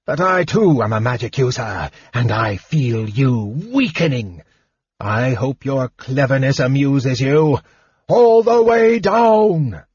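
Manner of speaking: dragon voice building to a roar; angry dragon voice. A narrator's reading. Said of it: "said the dragon angrily".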